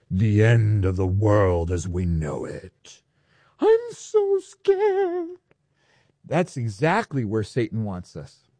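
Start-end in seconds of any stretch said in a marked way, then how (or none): 0.1-3.0 low, slow voice of doom; ominous doom voice. Shown as a demonstration. Preacher mocking end-times dread for the congregation, ominous doom voice. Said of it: "say it with an ominous doom voice". 3.6-5.3 high, drawn-out fake fear; mock terror. Preacher mimicking a listener frightened of doomsday, mock terror. Mocking fear after doom voice.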